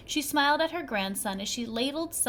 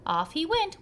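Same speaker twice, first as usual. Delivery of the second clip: usual speaking voice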